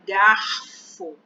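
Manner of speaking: garfo with the R clearly heard; R and F sounded. Shown as a demonstration. Teacher shows students garfo, each consonant clear. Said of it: "a gente escuta a letra R".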